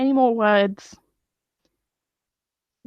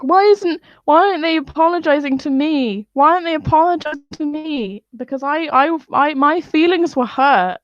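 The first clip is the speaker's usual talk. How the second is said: imitating Trump's whine, higher and louder; whiny mock Trump; 7 semitones higher, 4 dB louder than usual. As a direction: whine like a hurt child. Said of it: "he went on to tweet, like".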